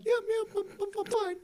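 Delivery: very high, stammering brush-off; high-pitched mock panic; frazzled employee. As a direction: imitate someone dazed and flustered, pitch way up. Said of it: "on drugs or something".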